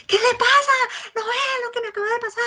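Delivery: the upset person snapping back at the helper; upset shout. Retold or imitated. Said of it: "La persona lo que va a hacer es".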